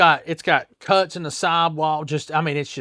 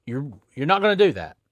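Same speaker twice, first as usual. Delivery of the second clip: loud, firm scolding; retold scolding; 4 semitones higher, 8 dB louder than usual. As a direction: put the rude customer in his place. [name] scolding rude customer.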